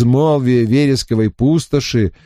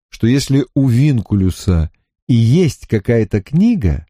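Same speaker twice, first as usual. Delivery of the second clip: usual speaking voice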